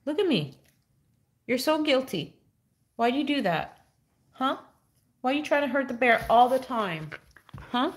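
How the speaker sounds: high sing-song scolding of a naughty dog; pet talk. Acted. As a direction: tease the dog like a cute little culprit.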